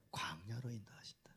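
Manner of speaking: soft unvoiced whisper. Real, not acted. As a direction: hush it like a secret. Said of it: "whispered answer".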